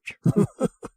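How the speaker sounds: high, scornful alien cackle; high mocking laugh. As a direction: laugh at their vanity. Alien head scorns human creation.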